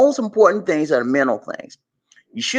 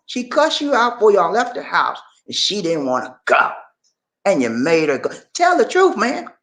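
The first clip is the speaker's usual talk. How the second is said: ordinary talk, nothing marked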